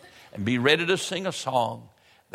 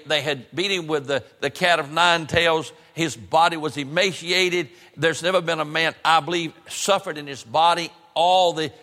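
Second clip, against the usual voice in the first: impassioned sermon, building to a higher pitch; preaching; 4 semitones higher than usual. Real, not acted. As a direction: preach it in driving, emphatic phrases. Preaches the crucifixion with rising intensity.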